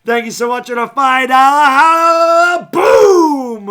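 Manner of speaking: loud, drawn-out, high announcer shout; slow booming shoutout; hyped shout. Real, not acted. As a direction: bellow the thanks like a hype man.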